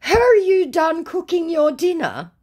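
sing-song the nosy question; mock scammer voice. Scammer prying about dinner, mocked high.

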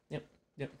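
usual speaking voice

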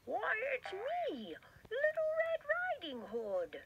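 high, slow, sugary fake sweetness; wolf fakes a sweet voice, high and slow; sweetest voice. Wolf tricking grandma at the door, sweetly. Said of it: "said the wolf in his sweetest voice".

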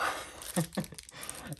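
short airy chuckle; pleased little laugh; soft breathy laugh. Real, not acted. Happy with the new pen.

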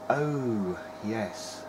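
usual speaking voice

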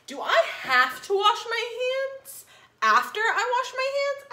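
high, rising puzzled princess; cartoon princess. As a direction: ask it like a silly little girl. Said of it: "asked the little princess".

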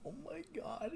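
stunned, breathless whisper; hushed disbelief, barely voiced; shocked whisper. Real, not acted. Shocked reaction to anime twist.

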